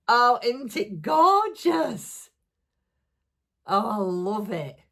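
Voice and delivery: high, loud gasp of delight, slowed right down; delighted gush. Real, not acted. Vlogger gushing to viewers over Dawn's handmade gift, high and loud. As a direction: swoon over the gift, drawing it out.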